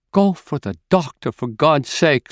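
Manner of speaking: high, urgent plea for help; frantic plea. Acted. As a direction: beg them to hurry. Mother begs for the doctor.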